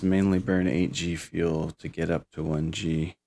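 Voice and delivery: usual speaking voice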